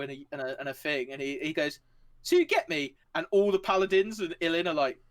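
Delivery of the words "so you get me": high eager question. Acted. Shah ends his long analogy.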